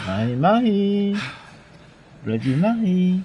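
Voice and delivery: slow, high singsong call; teasing summons. Pretend. Player calling out to a game enemy, slow singsong summons. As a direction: draw the name out, rising and holding it high.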